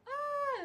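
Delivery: high, loud cry breaking into a laugh; scream of pain. Retold or imitated. Retelling the contraction to viewers: a high pained cry. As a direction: yelp through the wave of pain. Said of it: "and I'm".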